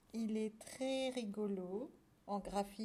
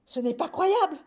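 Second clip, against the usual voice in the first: high, shouted disbelief; loud exclamation; 6 semitones higher, 13 dB louder than usual. A narrator's reading. Farmer discovering the mouse invasion, crying out. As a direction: cry out in outrage. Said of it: "s'écria Monsieur Poilu".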